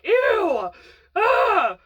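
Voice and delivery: high, loud groan of disgust. Real, not acted. Telling about the couple kissing, loud disgust. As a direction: recoil from the memory out loud.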